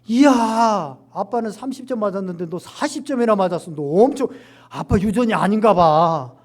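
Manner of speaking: loud, exaggerated proud-dad cheer; delighted praise. Father cheering his child's forty-point test, loud and delighted.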